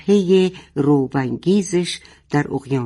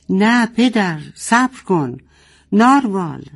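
higher, louder cry of no; screaming; 5 semitones higher, 3 dB louder than usual. Acted. Boteh stopping her father in alarm, pitched-up mock scream. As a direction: cry out to stop him. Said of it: "جیغ کشید".

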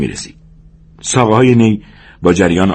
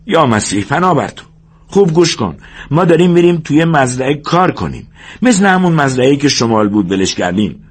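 higher-pitched exasperated minder; exasperated George; 7 semitones higher than usual. Acted. George reminds forgetful Lennie of the plan, exasperated. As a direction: explain it impatiently in a raised pitch.

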